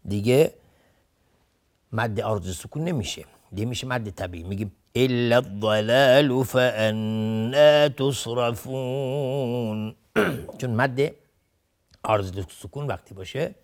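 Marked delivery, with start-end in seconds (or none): 5.0-9.9 slow chant carrying الضلال into فأنا with a normal-length vowel; passing with natural madd. Shown as a demonstration. Tajwid teacher showing students how to read on without stopping. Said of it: "اگر بخوایم عبور بکنیم".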